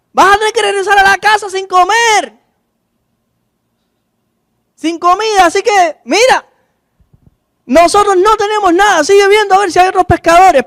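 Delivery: gritando más agudo, a medias, como desde el bote; gritos de pescadores. Retold or imitated. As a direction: pescadores que responden gritando a lo lejos, en broma. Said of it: "Y empezaron a gritar". (discípulos gritan al hombre de la orilla, un grito fingido y agudo)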